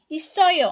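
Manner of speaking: ইসয় said loud and clear as a model word; pronunciation demo. Shown as a demonstration. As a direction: call out ইসয় crisply for learners to copy. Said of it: "ইসয় শব্দের যখন আমরা উচ্চারণ করছি".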